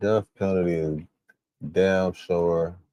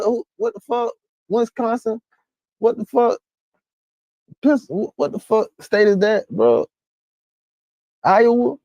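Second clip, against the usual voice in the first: high, loud, disbelieving questions; can't believe what he's reading; incredulous shock; 4 dB louder than usual. Real, not acted. Stunned by state's death penalty.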